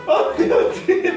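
laughing through the words, high; words squeezed out between giggles; stifled giggles. Real, not acted. Reacting to funny clips, laughing. (ridacchiando trattenuto)

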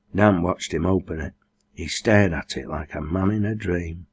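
ordinary delivery

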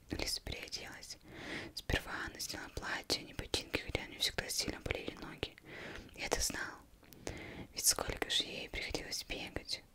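ordinary delivery, nothing marked

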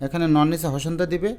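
ordinary talk

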